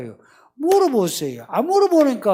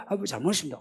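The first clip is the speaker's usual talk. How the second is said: kneeling apology; 4 semitones lower, 10 dB quieter, much faster than usual. Retold or imitated. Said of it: "무릎도 꿇고".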